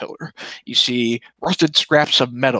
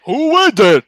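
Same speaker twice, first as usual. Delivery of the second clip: loud, higher door guard bellow; dopey cartoon bellow; 11 semitones higher, 9 dB louder than usual. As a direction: shout it like a dim doorman. Doorman voice behind door.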